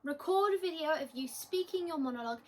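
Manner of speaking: usual speaking voice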